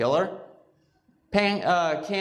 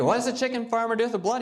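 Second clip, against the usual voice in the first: higher, fast, disbelieving repeat; incredulous; 6 semitones higher, much faster than usual. Retold or imitated. As a vegetarian doctor hearing about farms, fast incredulous question.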